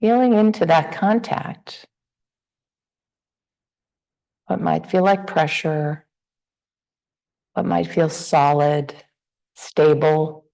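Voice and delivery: unhurried meditation guidance with long pauses; guided meditation. Real, not acted. Teacher guiding the group to relax into the body, guided meditation. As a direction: ease listeners gently through a body scan, leaving space between phrases.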